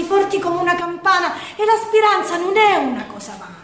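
ordinary talk, nothing marked

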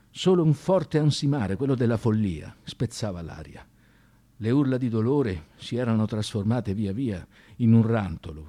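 ordinary talk

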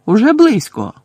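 high, loud call ahead; shouted. A narrator's reading. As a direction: shout it out to draw attention. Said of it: "крикнула Герміона".

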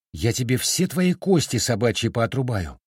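plain reading voice

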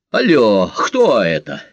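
big, forceful hello; booming, drawn-out telephone greeting; loud phone answer. Acted. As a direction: answer the phone loudly on a full breath. Takes deep breath, answers phone loudly.

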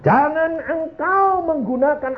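high, slow, sustained preaching; fervent exhortation. Real, not acted. Preacher warns congregation against relying on themselves, high drawn-out exhortation. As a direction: stretch it out as a ringing warning.

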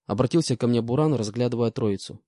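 ordinary delivery

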